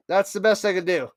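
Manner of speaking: normal talking voice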